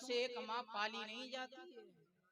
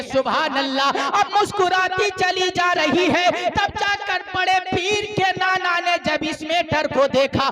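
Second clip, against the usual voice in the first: loud, high-pitched sermon storytelling; preaching at full force; 9 semitones higher, 27 dB louder than usual. Real, not acted. Preacher tells the gathering of a revived son, full-force preaching. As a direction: belt the story out to the crowd.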